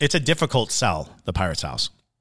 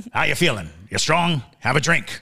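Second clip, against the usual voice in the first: a hint of a rough pirate recruiter; lightly growled pirate talk; 5 semitones higher, 3 dB louder than usual. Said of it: "I love your pirate voice".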